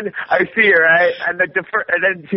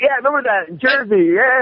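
mock dopey friend voice; 9 semitones higher than usual. Mimicking the friend's greeting.

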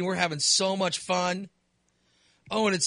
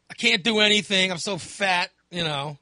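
usual speaking voice

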